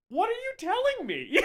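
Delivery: very high-pitched incredulous question; exasperated disbelief. Acted. Host reacting to the film's pointless plot, exasperated. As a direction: squeal it in baffled protest. Acts out baffled reaction to film.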